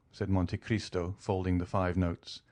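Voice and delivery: plain reading voice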